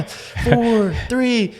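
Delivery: high, spaced-out countdown; imitating a camera woman counting down.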